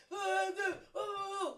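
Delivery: loud, high mock blubbering; imitating her ex bawling; crybaby sobbing. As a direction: ham up a grown man's whiny sob. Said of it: "the crybaby kind of crier".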